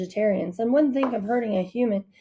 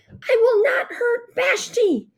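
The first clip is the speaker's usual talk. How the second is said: much higher, slow desperate cry; screaming; 4 dB louder, slower than usual. A narrator's reading. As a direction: scream your promise at the bear. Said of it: "I screamed at her".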